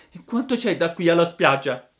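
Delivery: high, loud, urgent puppet question; anxious child puppet; breathless anxiety. A narrator's reading. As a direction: ask it anxiously, desperate to get there. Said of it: "domandò Pinocchio con ansia affannosa".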